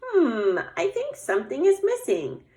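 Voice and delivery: high, sing-song little bear voice; Little Bear pondering. Acted. Little Bear studying her snowman, high pondering voice. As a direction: ponder aloud like a small storybook bear.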